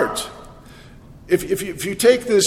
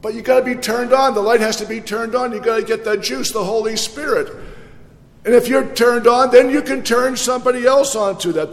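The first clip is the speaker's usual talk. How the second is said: ordinary talk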